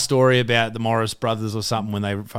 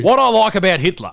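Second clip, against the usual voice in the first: loud, high mock announcement; 9 semitones higher, 7 dB louder than usual. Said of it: "he was just like".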